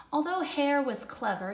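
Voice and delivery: plain reading voice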